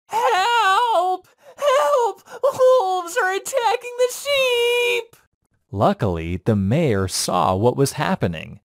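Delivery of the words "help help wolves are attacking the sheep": high, tearful shout for help; crying out in despair. Acted. Shepherd boy crying out in despair as wolves close in. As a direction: wail it as your last hope. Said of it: "cried out in despair".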